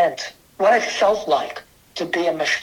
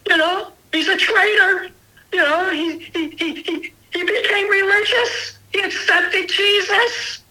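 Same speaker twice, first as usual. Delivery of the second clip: higher, crying mock scream of a frantic mother; retold mother's scream; 12 semitones higher than usual. Mother tells father their son became a traitor, higher tearful mock scream. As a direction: relive the mother shrieking, voice pitched up and tearful. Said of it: "My mother screamed at him and said".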